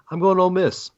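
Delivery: normal talking voice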